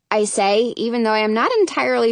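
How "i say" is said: ordinary delivery, nothing marked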